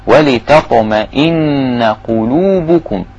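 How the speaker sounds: ولتطمئن with the نّ hummed for two counts, slowly; ghunna held. Shown as a demonstration. Teacher recites slowly, holding the nasal ن. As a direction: hum the doubled ن of ولتطمئن. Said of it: "يبقى لازم أغن حركتين".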